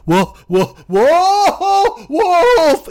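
high, loud cries rising, cracking into a laugh; yelling wolf. Acted. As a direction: shout it again, half laughing at the hopelessness. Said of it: "He yells, finally, with everything he can".

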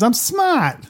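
put-on high Boston accent "I'm smart"; a hint of Fredo's Boston accent. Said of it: "Isn't that why he says".

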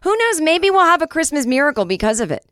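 excited announcement. Real, not acted. Proud host announces sister's debut.

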